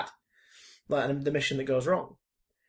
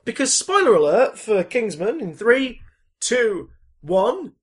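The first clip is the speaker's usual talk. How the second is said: dramatic countdown; 6 semitones higher, 8 dB louder than usual. Acted. Mock countdown spoiler reveal.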